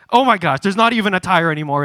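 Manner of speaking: acted-out horrified outburst; shocked discovery. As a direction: exclaim in alarm at the damage. Acts discovering the ruined tire.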